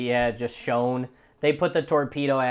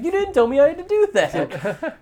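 dumbfounded whine; 11 semitones higher, 5 dB louder than usual. Retold or imitated. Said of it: "And he's like".

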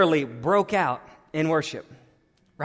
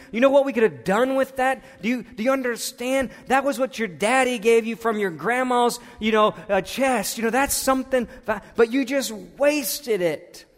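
rebuking scold; 6 semitones higher, 3 dB louder than usual. Retold or imitated. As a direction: scold her as the wasteful one. Disciples scolding Mary over the perfume.

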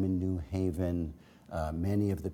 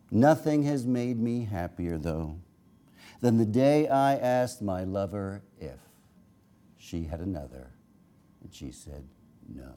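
poetry reading; 3 semitones higher, 3 dB louder than usual. Acted. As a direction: read the poem out, pausing at line breaks. Reads a poem aloud.

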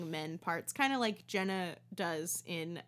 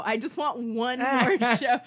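louder, laughing desperate whine; desperate plea; 3 semitones higher, 9 dB louder than usual. Shown as a demonstration. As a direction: beg for it like an addict. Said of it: "another way I would punch this up".

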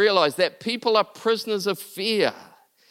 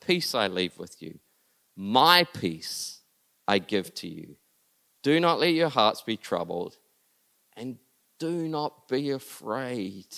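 gentle quoting; 8 semitones lower, 4 dB quieter than usual. Acted. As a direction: speak it like a tender farewell. Quotes Jesus's farewell gently.

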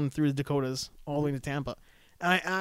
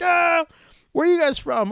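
cheery surprised reply; 12 semitones higher, 8 dB louder than usual. Retold or imitated. Canadians answering cheerfully.

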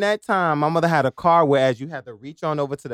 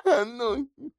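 mock crying; 8 semitones higher than usual. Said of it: "crying before she even hit me".

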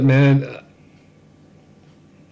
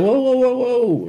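alarmed whoa; 10 semitones higher, slower than usual. Retold or imitated. Van rolling forward unexpectedly.

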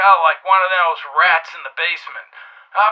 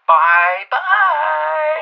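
high, lilting bye-bye; a lilting phone farewell; drawn-out goodbye; 6 semitones higher than usual. Acted. Signing off a teasing voicemail.

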